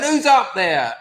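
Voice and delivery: teacher calling up. Retold or imitated. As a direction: shout up into the dark loft. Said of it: "one of the teachers is going".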